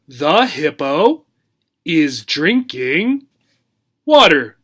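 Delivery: put-on slow, word by word for children to repeat; slow modeling. Shown as a demonstration. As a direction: say it slowly so kids can copy. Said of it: "Can you say it?".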